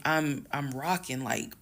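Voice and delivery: ordinary delivery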